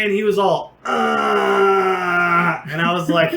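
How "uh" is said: long, drawn-out dazed groan; drunken groan. Retold or imitated. Drunk friend flat on the sidewalk, imitated long groan.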